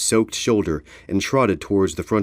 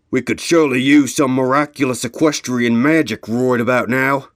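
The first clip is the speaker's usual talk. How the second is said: foreman pony; 5 semitones higher, 5 dB louder than usual. Acted. Full Trot asks for magic.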